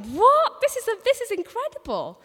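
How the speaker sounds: high, loud astonished cry of disbelief; amazed kids. Retold or imitated. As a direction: voice the kids' jaw-dropping amazement. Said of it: "They were just like".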